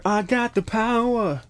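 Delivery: high, slow sung-out message; voicing the spirit's words, high and slow; channeled message. Tarot reader relaying a heard message, high and drawn out. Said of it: "I just heard".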